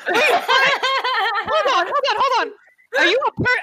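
high, loud stunned disbelief. Real, not acted. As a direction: blurt out in laughing shock. Player stunned by shark being person.

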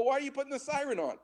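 high, rushed, disbelieving question; baffled protest. Retold or imitated. Student to ambulance driver about the siren, baffled protest. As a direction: retell it as a quick, incredulous objection.

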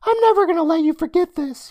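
put-on loud, squeaky high kid; very high, mocking child voice; teasing boy. A narrator's reading. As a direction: gloat like a little brother. Day Day keeps teasing.

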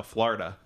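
"Florida" with ah in first syllable; New Jersey pronunciation. Shown as a demonstration. Correcting co-host's repeat, "Florida" said FLAR-i-da. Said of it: "I say Florida".

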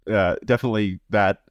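ordinary delivery

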